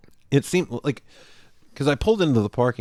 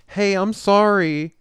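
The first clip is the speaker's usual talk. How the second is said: apologetic; 11 semitones higher, 5 dB louder, slower than usual. Retold or imitated. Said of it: "going to lean in to be like".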